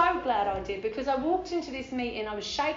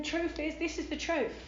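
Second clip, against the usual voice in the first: ordinary talk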